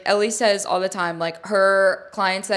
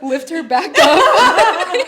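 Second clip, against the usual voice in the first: slow, higher, laughing; 9 semitones higher, 5 dB louder, much slower than usual. Real, not acted. Waxer laughs recounting lifting the client. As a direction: drag it out through laughter.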